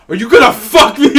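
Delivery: retold aggressive demand; mock yell. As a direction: shout it in her voice, hammed up. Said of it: "and says".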